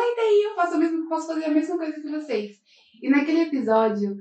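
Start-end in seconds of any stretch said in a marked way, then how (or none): 0.0-2.5 higher, defiant comeback; defiant retort. Retold or imitated. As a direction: toss it back defiantly. Retelling her defiant reply.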